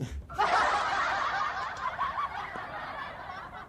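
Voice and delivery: a long, high burst of real laughter; high laughing fit. Real, not acted. Reacting to his Facebook ban, high real laughter. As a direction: let the laugh break out high.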